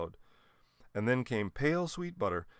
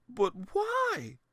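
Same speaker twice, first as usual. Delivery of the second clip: put-on high, baffled old lady; a grandmother reeling from news. A narrator's reading. Grandmother shocked.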